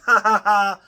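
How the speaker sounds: mock laughter. Passing police in the street, loud mocking laugh. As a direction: laugh in their faces. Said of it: "you just feel like laughing".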